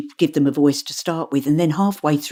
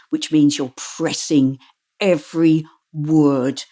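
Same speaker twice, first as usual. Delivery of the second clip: slow, every word pressed firmly; pressing every word; slower than usual. Shown as a demonstration. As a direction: lean hard on every single word, slowly. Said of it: "That's a bit exaggerated".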